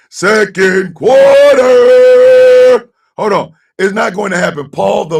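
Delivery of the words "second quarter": drawn-out, loud announcer call. Acted. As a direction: stretch it slowly like a stadium announcer. Announcing the quarter.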